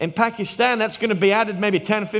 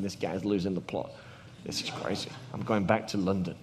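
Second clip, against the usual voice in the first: private thought; 10 semitones lower, 11 dB quieter than usual. Retold or imitated. Inner thoughts, muttered aside.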